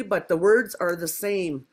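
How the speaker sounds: usual speaking voice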